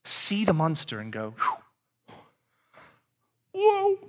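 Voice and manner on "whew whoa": high loud startled whoa; hammed-up recoil; mock startle. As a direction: jump back in mock fright. Voicing Jesus seeing monster.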